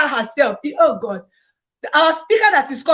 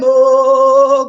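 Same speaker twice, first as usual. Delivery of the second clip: loud oh; 7 dB louder than usual. Real, not acted. Loud drawn-out exclamation.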